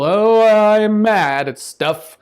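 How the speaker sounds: high, loud, slow mock yell; hammed-up angry shout. Shown as a demonstration. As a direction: yell it like a cartoon of anger.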